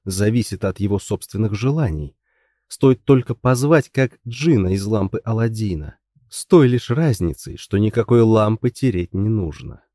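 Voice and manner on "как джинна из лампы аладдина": plain reading voice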